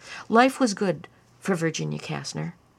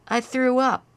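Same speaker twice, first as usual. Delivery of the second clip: put-on higher child voice, pleading; a sick little boy begging not to be scolded; young boy pleading; 6 semitones higher, 5 dB louder than usual. A narrator's reading.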